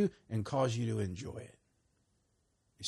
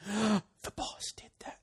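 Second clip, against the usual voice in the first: slow, breathless whisper; awed whisper; 9 semitones higher, 4 dB quieter, slower than usual. Retold or imitated. Pointing upward after the friend's clean results, awed whisper. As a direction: hush it in wonder.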